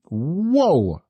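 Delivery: sudden startled exclamation; jolted mid-sentence by a fly; startled whoa. Real, not acted. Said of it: "fly just flew into my mouth".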